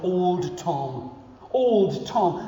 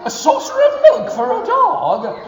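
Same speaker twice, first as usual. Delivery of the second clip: very high, loud laughing disbelief; astonished; 9 dB louder than usual. Acted. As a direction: squeal it in amazed disbelief. Astonished at dog's request.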